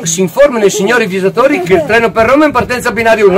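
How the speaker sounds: fast announcer. Acted. Hurried announcer parody.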